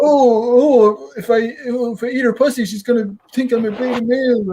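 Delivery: high, whiny mock of a nervous guy fretting; whiny mock insecure guy. Mocking an insecure guy about what she'll think, high and whiny. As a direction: mimic a scared guy in a high fretful voice. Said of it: "I'm not gonna be like".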